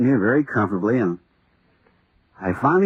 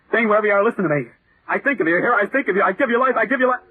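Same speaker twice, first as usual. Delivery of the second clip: higher and higher, calling it into being; a man willing his imagined monster to life; feverish summoning; 8 semitones higher, faster than usual. Acted. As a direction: conjure the creature with rising fervor.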